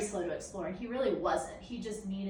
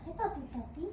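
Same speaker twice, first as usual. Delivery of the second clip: higher sing-song puppy call; high pet talk; 6 semitones higher, 4 dB quieter than usual. Real, not acted. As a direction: coo at the puppy. Calling the puppy sweetly.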